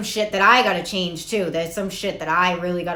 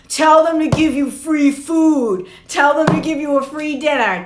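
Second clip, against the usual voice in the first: mock yell from the background; 7 semitones higher, 7 dB louder than usual. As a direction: holler it from across the room. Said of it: "my stepdad's yelling in the background".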